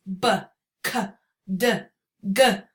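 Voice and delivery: B, k, d, g, h each cut off short; short sharp stop sounds, no trailing vowel; clipped stop sounds. Shown as a demonstration. Teacher showing parents stop sounds, each cut short. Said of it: "my voice either keeps going or it stops".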